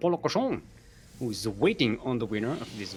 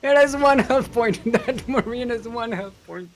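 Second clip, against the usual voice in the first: high laughing disbelief; 11 semitones higher, 5 dB louder than usual. Real, not acted. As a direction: laugh through the words. Laughing at one-health marine.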